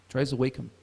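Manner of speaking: normal talking voice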